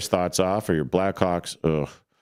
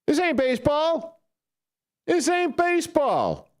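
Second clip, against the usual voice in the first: mock high voice. Mocking the pitch count phrase.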